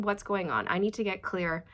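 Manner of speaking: ordinary delivery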